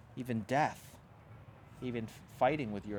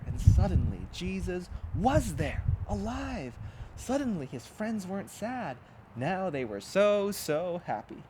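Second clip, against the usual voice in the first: joyful excitement; 5 semitones higher, 4 dB louder than usual. A narrator's reading. Joyful resurrection scene.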